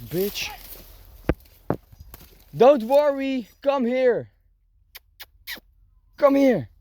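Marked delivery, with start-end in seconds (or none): 2.6-6.7 high, loud coaxing call to the dog; sing-song call across the field for a dog; bright high call, coaxing a pet. Real, not acted. Calling the dog to come.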